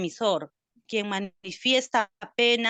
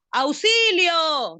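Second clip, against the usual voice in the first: louder, higher, slow cry of help; demo cry for help; 6 semitones higher, 5 dB louder, much slower than usual. Shown as a demonstration. Student demonstrates the emotive function with a louder, slow call for help. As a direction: show how a frightened person calls for help. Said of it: "Que gritan".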